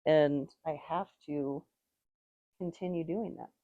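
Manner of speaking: usual speaking voice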